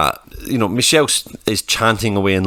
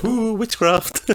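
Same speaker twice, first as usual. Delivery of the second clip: very high, slow spooky "Ooh, witchcraft"; mock spooky; slower than usual. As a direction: hoot it like a cartoon ghost. Echoes the spooky quote.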